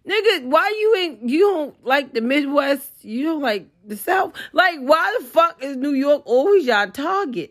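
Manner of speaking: exasperated high-pitched rant; real exasperated outburst. Real, not acted. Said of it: "That was an outburst".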